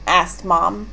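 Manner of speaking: ordinary delivery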